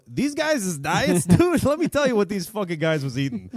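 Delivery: hammed-up New York tough-guy accent; New York wiseguy accent. Repeats topic as a streetwise tough guy.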